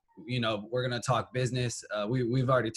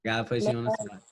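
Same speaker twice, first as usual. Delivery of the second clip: teasing sing-song; 9 semitones higher than usual. Real, not acted. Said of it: "repeats the tease higher".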